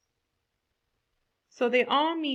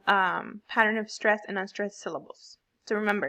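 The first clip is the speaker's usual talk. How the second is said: ordinary talk, nothing marked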